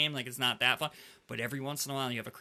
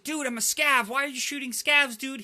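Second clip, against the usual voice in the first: high, indignant complaining voice; mad scav player; 9 semitones higher, 3 dB louder than usual. Retold or imitated. Imitating the angry scav player, high and indignant. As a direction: whine it like you're outraged. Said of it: "he gets so mad".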